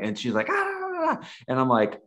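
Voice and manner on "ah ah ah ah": high mock yells of an upset woman; imitated angry yelling. Speaker imitates the lady yelling at his camera, mock yells. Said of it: "and she is yelling".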